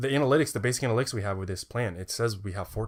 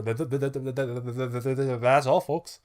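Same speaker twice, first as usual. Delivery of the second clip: Porky Pig stutter; 4 dB louder than usual. Retold or imitated. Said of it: "Porky the Pig. Look it up".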